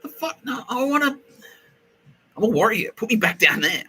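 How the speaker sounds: high-pitched, loud mock protest; squeaky, hammed-up indignation; indignant protest. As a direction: imitate an outraged recruit demanding to go back. Retells Millie's indignant protest.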